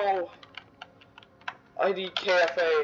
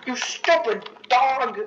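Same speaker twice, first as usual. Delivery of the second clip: higher, slow exasperated scold; frustrated scolding; 6 semitones higher, slower than usual. Real, not acted. Gamer yelling at his useless puppy companion, exasperated. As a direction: drag it out at the useless dog.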